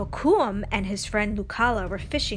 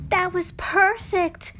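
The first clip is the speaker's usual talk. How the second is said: higher, louder, slow delighted yell; excited yell; 10 semitones higher, 6 dB louder, slower than usual. A narrator's reading. Girl yelling praise at a friend after a dive. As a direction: cheer her friend's dive from afar. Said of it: "Nakoma yelled".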